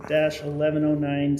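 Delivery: usual speaking voice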